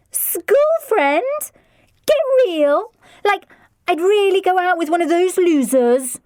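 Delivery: high, loud scornful sneer; scathing teen. A narrator's reading. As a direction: sneer it like a bored teenager. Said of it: "Lydia replied scathingly".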